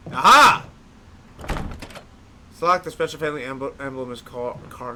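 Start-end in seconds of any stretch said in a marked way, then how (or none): 0.1-0.6 loud high aha of discovery; triumphant aha. Real, not acted. Finding the locked door in the game, triumphant aha. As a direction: crow at the find.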